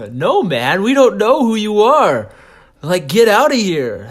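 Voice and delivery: high, loud mock outrage; hammed-up annoyed neighbours; indignant rejection. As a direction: shoo off a drunk stranger at full voice. Strangers send him away.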